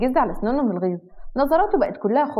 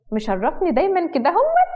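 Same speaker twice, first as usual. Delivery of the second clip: high-pitched pride, last word drawn upward; proud; 7 semitones higher than usual. A narrator's reading. Wife laughs and praises her husband, proud. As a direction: gush proudly, pitch climbing to the end. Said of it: "واتكلم بفخر".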